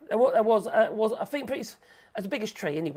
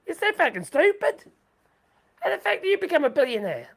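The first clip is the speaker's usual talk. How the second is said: high, loud jeering taunt, faster on the question; mocking disbelief; 9 semitones higher, 4 dB louder than usual. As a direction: jeer at him like the crowd in shock. Crowd mocking him, laughing, pointing.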